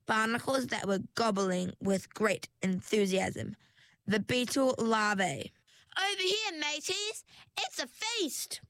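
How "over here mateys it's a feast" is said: barnacle cry. A narrator's reading. Barnacle calling its mates to the feast, high. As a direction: call the crew to dinner. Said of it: "cried Barnacle".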